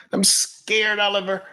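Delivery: high-pitched, whiny put-on panic; mock scared follower. Scared follower pleading with Oliver about Bitcoin, mock-frightened.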